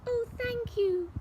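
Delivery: high, relieved thanks; grateful cry. Acted. Witch thanking the animals, high and grateful. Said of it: "the grateful witch cried".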